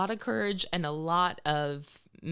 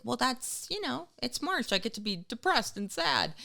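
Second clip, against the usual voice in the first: self-justifying whine; 7 semitones higher than usual. Retold or imitated. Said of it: "And I was like".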